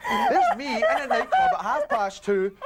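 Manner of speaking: high, loud laugh-talk; cracking up while telling it. Real, not acted. Teller laughs while describing puking in the club. Laughing through the gross story.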